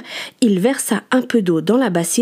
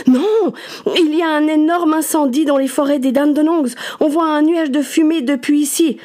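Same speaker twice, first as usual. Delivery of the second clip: urgent; 7 semitones higher than usual. Acted. Benjamin urgently correcting Martha.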